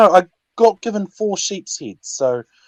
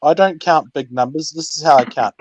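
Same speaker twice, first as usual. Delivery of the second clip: ordinary talk, nothing marked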